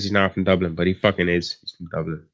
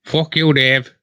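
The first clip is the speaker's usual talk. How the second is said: loud, higher mock drunk Irish growl; mock Emer Lyle; 5 semitones higher, 4 dB louder, slower than usual. Imitating a friend's Irish accent to a pal, loud and higher. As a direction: imitate a mumbling Dublin mate. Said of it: "He's like".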